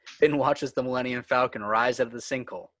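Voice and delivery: normal talking voice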